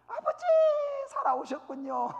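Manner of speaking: hammed-up squeal of joyful relief; overjoyed daughter. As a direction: play a delighted daughter greeting Father. Daughter runs to greet father.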